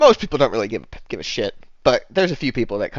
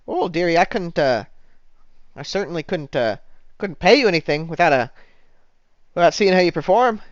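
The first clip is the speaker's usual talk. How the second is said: higher, hesitant bartender; 6 semitones higher, 4 dB louder than usual. Acted. Bartender won't pay without seeing him perform, higher hesitant role voice. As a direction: let him down sweetly in a higher put-on voice. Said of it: "she says".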